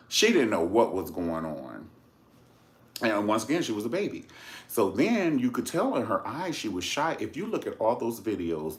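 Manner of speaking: usual speaking voice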